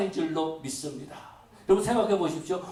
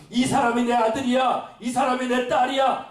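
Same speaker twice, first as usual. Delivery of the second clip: raised, slow proud boast; proud God voice; 6 semitones higher, 5 dB louder than usual. Acted. Preacher voicing God boasting to the enemy, proud and raised. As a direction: boast about your children to the enemy. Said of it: "원수에게 말하고 싶은 겁니다".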